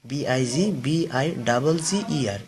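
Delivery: slow letter-by-letter spelling, বিআই double জি ই আর; slowly spelling বিআই double জি ই আর letter by letter. Shown as a demonstration. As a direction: spell each letter deliberately for the class. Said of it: "শেষের কনসোনেন্টটা আমরা double করে দেবো".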